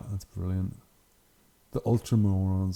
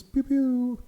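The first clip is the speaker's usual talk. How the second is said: mock laser sound. Imitates missile launchers firing.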